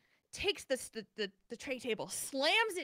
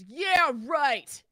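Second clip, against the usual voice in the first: loud sarcastic scoff, pitched up; 6 semitones higher, 10 dB louder than usual. Retold or imitated. Angry passenger mocking the flight attendant, loud sarcastic scoff. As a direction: scoff at the welcome like an angry drunk. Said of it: "He goes".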